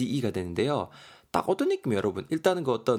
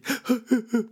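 laughing at his own acting; high burst of laughter; 12 semitones higher, 3 dB louder than usual. Real, not acted. Teacher laughing at his own mock wailing, high laugh. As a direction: squeal of laughter. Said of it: "저는 왜 이러는 걸까요".

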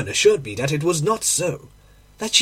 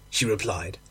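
ordinary delivery

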